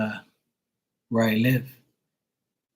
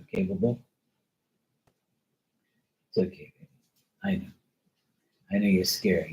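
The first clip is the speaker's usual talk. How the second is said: soft reassuring coo to a scared dog; calm the frightened dog gently, quieter; soothing pet; 2 semitones lower, 5 dB quieter, faster than usual. Real, not acted.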